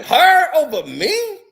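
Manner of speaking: outraged disbelief. Retold or imitated. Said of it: "She was like".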